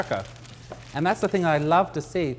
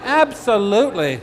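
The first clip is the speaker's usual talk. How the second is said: slow, louder laughing absolutely; laughing yes; 5 semitones higher, 7 dB louder than usual. Pretend. Florist mock-answering a customer with a laughing yes. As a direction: overplay the eager yes. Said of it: "does it come in other colors".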